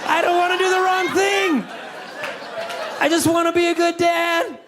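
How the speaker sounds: very high, strained panicky dad voice; high-pitched nervous dad voice; anxious high voice. Retold or imitated. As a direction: squeak out the worry like a frantic parent. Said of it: "I'm just like".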